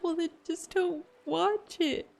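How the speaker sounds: high, drawn-out dismissive reply; high mock-innocent comeback; mock-sweet retort. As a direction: toss it back in a high, slow voice. Retold reply to hateful comments.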